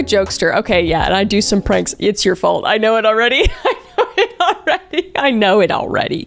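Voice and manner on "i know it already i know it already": high squeaky laughing voice; giggly squeal. Real, not acted. Reader cracking up over poster's fault, giggly squeal.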